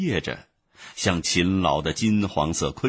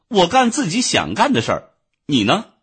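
higher, louder blunt retort; stiff curt reply; 10 semitones higher, 6 dB louder than usual. A narrator's reading. Ant bluntly answers the haughty bee, curt and stiff. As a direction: snap back without courtesy. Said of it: "就语气生硬地回答说".

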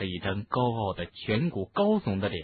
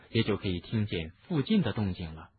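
ordinary delivery, nothing marked

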